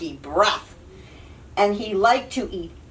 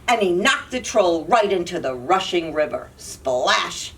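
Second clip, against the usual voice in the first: ordinary delivery, nothing marked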